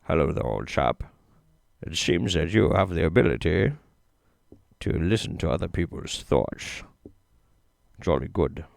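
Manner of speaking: low, soft put-on British gent; hushed, deep, badly done celebrity impression; Sean Connery. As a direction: drop the pitch and address them like an old chap. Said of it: "Hmm, Sean Connery".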